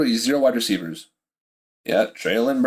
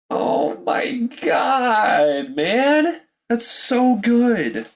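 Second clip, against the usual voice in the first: half-crying rave; overwhelmed outcry; 8 semitones higher, much slower than usual. Real, not acted. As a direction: cry out in awe at the deal. Overwhelmed by the Eagles trade.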